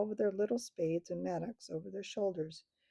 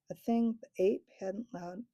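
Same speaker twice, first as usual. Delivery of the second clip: usual speaking voice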